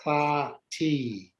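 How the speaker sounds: chatati with a rasped throaty ch; guttural ch. Shown as a demonstration. To the class, teacher drills the throaty ch. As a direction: scrape the ch in chatati slowly. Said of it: "getting the ch".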